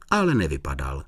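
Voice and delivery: ordinary delivery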